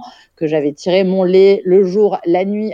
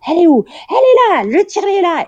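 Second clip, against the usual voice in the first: high, loud frantic calls; mock alarm, shouting across the room; high-pitched excited shouting; shrilly calling out; 12 semitones higher, 5 dB louder, faster than usual. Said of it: "j'entends genre".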